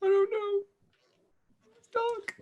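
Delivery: very high, small ogre voice; frightened ogre. Acted. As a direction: whimper it like a scared little creature. Scared ogre answers interrogation.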